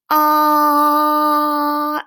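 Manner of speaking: long loud ah held until breath runs out. Shown as a demonstration. Teacher to children showing a continuous sound, long held ah. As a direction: hold the ah as long as you can. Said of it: "Do you hear how it goes".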